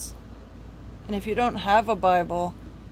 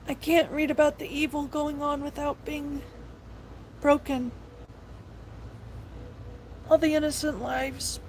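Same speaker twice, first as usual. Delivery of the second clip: real tears, softer and higher; crying while speaking; 3 semitones higher, 7 dB quieter than usual. Real, not acted. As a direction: fight through tears as you speak. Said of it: "I'm sorry to be crying".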